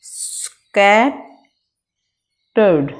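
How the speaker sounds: slow, the word broken in two with a pause: स्कैट... टर्ड; split into syllables. Shown as a demonstration. Teacher showing students the syllable break, slow and split. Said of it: "next है scattered".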